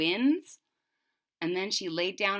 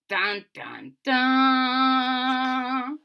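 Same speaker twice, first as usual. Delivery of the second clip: dun dun dun; 5 semitones higher, 6 dB louder, much slower than usual. Pretend.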